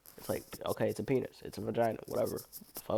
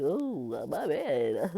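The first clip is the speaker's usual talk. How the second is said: higher, sheepish mock apology; the naked guy shrugging off his mistake, voiced higher; 4 semitones higher, much slower than usual. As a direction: brush it off like a dopey guy. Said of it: "He's like".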